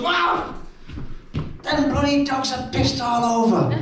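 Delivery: high shocked yelp into grumble; mum's shocked outburst. Retold or imitated.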